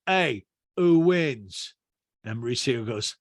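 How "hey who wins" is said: loud, drawn-out question in imitation. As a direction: mimic a man egging on his mate. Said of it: "And P K is like".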